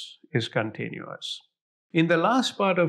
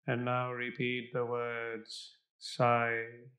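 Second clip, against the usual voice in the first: guided meditation; 3 semitones lower, 3 dB quieter than usual. Acted. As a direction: fade softer toward silence. Guided visualization meditation continues.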